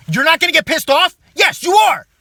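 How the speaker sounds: high, loud, building to a shout; emphatic shout. Acted. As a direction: yell the answer back at them. Shouting answer to own question.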